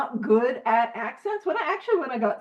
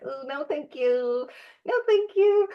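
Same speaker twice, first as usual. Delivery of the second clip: squeamish refusal; 2 semitones higher than usual. Acted. Sock-wearer turning down bare feet, squeamish refusal. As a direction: play up a fussy, polite refusal.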